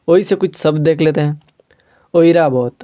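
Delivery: ordinary talk, nothing marked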